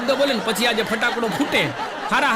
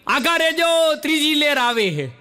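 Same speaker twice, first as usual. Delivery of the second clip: shouted alarm, pitched up; kid's shout; 7 semitones higher than usual. Retold or imitated. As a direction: call out a warning from the window. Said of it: "તો રાડ પાડી".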